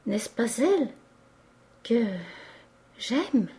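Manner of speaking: halting, with long gaps between the words; stammering. A narrator's reading. Clerk falters as he asks his patron, stammering. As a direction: falter timidly through the question. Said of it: "dit le commis en balbutiant".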